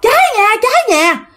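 very high frantic yell of fire. Retold or imitated. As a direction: shout the alarm to the neighbors. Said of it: "mợ đó la". (mợ hàng xóm la cháy nhà, giọng rất cao)

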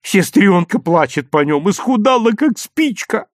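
wheedling appeal; plaintive drawn-out plea. A narrator's reading. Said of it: "затянул Лёвка".

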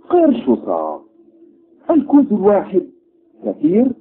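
shocked haggling. Acted. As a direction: balk at the price, outraged. Doctor shocked at price.

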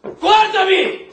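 shouted order. Acted. Interrogator yelling at prisoner to look at him, a slow shout. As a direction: make him look at you.

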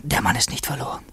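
hushed whisper, thinking to himself; whispered inner thoughts. Acted. Andrejew thinking about the governor, in a whisper. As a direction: barely breathe the words as a private thought.

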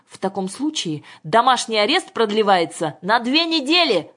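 shouting after her. A narrator's reading. Father extends house arrest to two weeks, shouting. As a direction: raise the voice to a shouted verdict. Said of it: "крикнул ей вдогонку Мишин".

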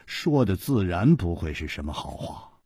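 usual speaking voice

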